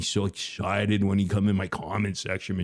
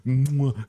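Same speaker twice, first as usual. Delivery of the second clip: loud smacking air kiss; kiss sound; 5 semitones higher, 3 dB louder than usual. Pretend. Streamer blowing a kiss to chatter Michelle, loud smack. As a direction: blow a big loud kiss. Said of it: "I wanna kiss you".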